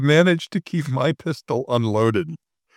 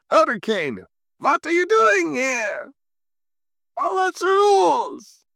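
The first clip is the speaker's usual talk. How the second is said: put-on high, slow shrill German officer accent; Major Hochstetter German accent; slower than usual. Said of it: "'cause it's Major Hochstetter".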